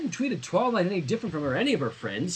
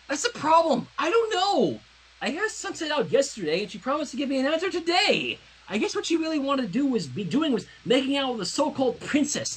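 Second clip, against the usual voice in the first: put-on upset girl; 8 semitones higher than usual. Jealous Twilight complains, pitch high.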